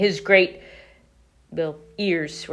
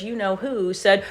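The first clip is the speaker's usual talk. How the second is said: usual speaking voice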